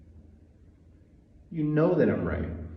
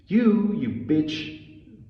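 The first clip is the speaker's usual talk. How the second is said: stretched out, higher and louder; furious yell; 7 semitones higher, 3 dB louder, much slower than usual. Real, not acted. Ranting at Netanyahu in a slow furious yell. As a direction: hurl it at him, dragging each word.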